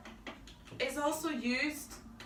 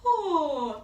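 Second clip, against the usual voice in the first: yawned oh; 6 semitones higher, 10 dB louder than usual. Shown as a demonstration. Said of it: "is the yawn".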